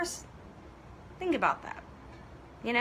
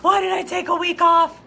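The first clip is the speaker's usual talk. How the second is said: louder, slow tearful cry of dismay; tearful outcry; 4 semitones higher, 9 dB louder than usual. Retold or imitated. Retelling to viewers her crying regret, tearful loud cry. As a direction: break out in a teary shout. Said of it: "I was kinda crying doing that".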